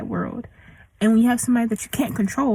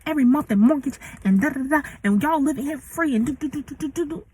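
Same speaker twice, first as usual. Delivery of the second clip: higher, mocking mom voice trailing off into da da; a pitched-up parody of an indignant mother; imitating her mom; 4 semitones higher, faster than usual. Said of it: "but she was like".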